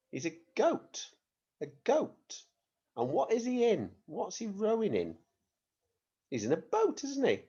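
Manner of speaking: high sing-song teacher prompts; high guessing voice. Shown as a demonstration. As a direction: pitch up high like prompting little ones. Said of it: "What type of creature is that?".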